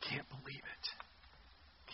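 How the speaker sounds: slow, breathy whisper of disbelief; whispered mutter. Acted. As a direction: mutter it under your breath in disgust. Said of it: "He's just mumbling under his breath".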